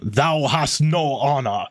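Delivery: slow, put-on god's voice; comic-book character voice. Podcaster demonstrates the voice heard when reading the script, slow put-on voice. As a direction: proclaim it slowly as a comic-book god. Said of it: "I was doing voices".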